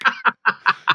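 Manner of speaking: high-pitched burst of laughter. Real, not acted. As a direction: laugh at the memory. Laughing at own story.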